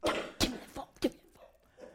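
unvoiced whisper, very quiet; mock stern whispered order; hushed demand. Man demanding the phone from the woman teasing him, hushed demand.

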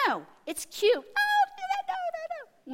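put-on very high little toddler voice; cute defiant toddler. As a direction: squeak it like a tiny child refusing. Mimics defiant toddler, cooing at it.

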